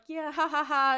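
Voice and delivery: loud, awkward fake laugh; forced laugh. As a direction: laugh her out the door. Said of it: "And Bobby's like".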